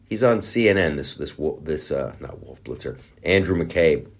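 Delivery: normal talking voice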